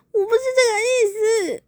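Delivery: high, teary whine with a cry in it; half-sob the protest, hammed up; mock tearful. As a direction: girlfriend crying at the boyfriend in the car, teary whine. Girlfriend crying in car.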